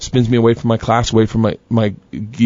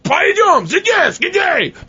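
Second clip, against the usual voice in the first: barking cop; much slower than usual. Retold or imitated. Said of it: "bark out in Russian".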